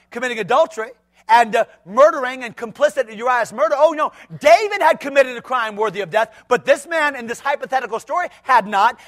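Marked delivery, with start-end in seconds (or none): none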